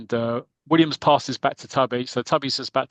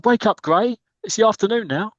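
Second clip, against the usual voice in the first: high, raised jeer; mock crowd heckle; 9 semitones higher, 4 dB louder than usual. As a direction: heckle a sleepy player from the stands.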